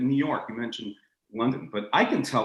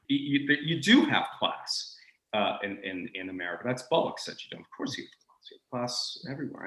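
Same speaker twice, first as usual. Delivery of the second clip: normal talking voice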